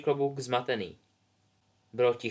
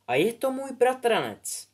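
lightly voiced proud girl; 11 semitones higher, 3 dB louder than usual. Rose introduces her cousin.

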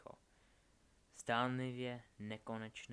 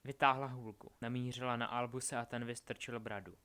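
ordinary delivery

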